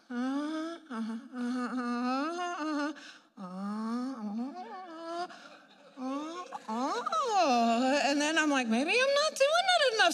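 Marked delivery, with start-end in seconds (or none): 0.1-8.0 soft, halting mock groans; little drawn-out groans of cautious effort; tentative straining groans. Patient gingerly moving what hurts, tentative groaning. 8.7-10.1 very high, squeaky-pitched wondering; mock self-doubt. Patient deciding to try moving more, high mock self-doubt.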